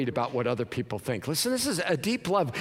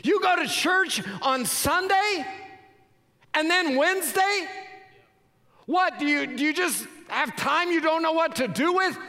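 mocking disbelief; 13 semitones higher than usual. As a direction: scoff at the churchgoer. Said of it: "voicing the world's mockery".

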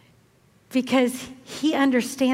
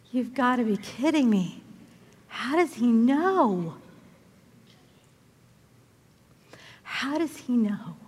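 stunned disbelief; 4 dB quieter than usual. Acted. Preacher voicing the woman found out by Jesus, stunned. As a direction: let the question stretch out, then repeat it hushed.

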